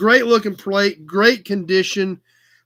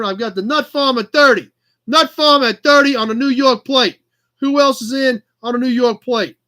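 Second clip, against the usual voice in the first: hammed-up New York auctioneer; terrible New York accent. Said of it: "listen to my terrible accent".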